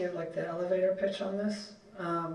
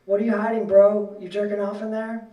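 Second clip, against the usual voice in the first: taunting shout; 3 semitones higher, 9 dB louder than usual. Acted. Dorm kids taunting in the stall.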